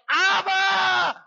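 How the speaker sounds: high, full-force shout; crying out in agony. Acted. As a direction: scream it out high and loud like a desperate plea. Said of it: "it's like Jesus is crying".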